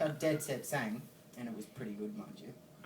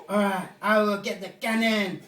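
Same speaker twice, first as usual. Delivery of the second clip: loud, high, stretched-out announcement; mock commanding yell; 7 semitones higher, 11 dB louder, slower than usual. French guy when the man won't go down, mock commanding yell. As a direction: declare it like a hammed-up commander.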